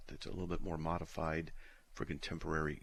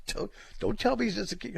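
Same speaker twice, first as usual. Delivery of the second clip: imitating wife; 14 semitones higher, 5 dB louder than usual. Wife pleading, retold by husband.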